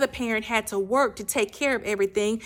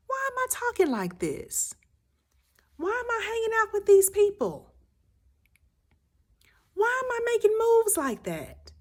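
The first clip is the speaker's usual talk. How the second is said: put-on much higher, childlike questioning voice; high childlike voice of the inner child; 12 semitones higher than usual. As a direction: ask it like a small confused child. Voicing the hurt inner child.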